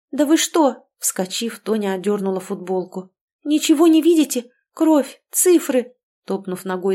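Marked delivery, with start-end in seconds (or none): 0.1-0.9 higher, louder, indignant outburst; agitated cry. A narrator's reading. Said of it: "закричала она". 3.4-5.9 high, loud, desperate outcry; agitated scream. A narrator's reading. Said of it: "закричала она".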